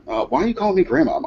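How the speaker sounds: lightly voiced indignant grandma; imitated puzzled grandmother. Confused grandmother corrects her grandson.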